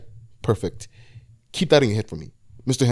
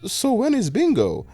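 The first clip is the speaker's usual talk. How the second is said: put-on high, whiny old woman asking; high old-lady whine; frowning old lady; 12 semitones higher than usual. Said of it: "frowned and said".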